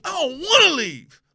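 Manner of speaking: high, slow whining; whiny complaint. Retold or imitated. Fan whining to his grandmother, high and slow. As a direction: whine like a kid told to go home. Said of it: "he goes".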